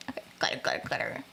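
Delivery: a soft playful whisper. Real, not acted. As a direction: breathe the words under the breath. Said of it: "whispered sparkle sound".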